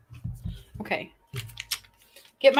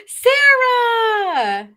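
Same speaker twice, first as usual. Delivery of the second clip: gasping, delighted call; excited shout; 12 semitones higher, 8 dB louder than usual. Real, not acted. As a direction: greet her with a thrilled yell. Excited greeting of arriving viewer.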